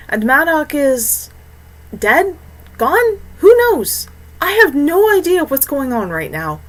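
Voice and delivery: rising distress. Acted. Tyrell overwhelmed by loss.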